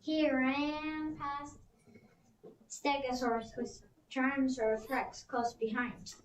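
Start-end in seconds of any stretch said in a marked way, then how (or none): none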